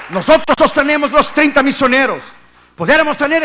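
loud, hard challenge to the listeners; loud confrontation. Acted. Preacher daring the congregation to swallow the truth, loud. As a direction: throw the truth at them.

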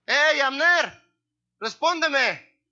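high calling shout, Respóndeme slow; David shouting. Acted. David on the hilltop yelling down at Abner, hammed-up shout. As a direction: call out across the valley to Abner. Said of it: "empezó a gritar".

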